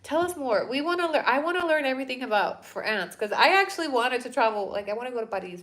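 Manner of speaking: ordinary delivery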